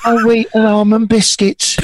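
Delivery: high-pitched comic impression; Mister Cray. Co-host reading out biscuits for a laugh on request, high-pitched voice. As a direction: put on a high-pitched voice for the product name. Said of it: "Mister Cray would do it in a high pitched voice".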